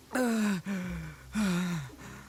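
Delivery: long weary exhale; heavy sigh. Acted. Itto catches his breath with a heavy sigh. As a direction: let the breath go, spent.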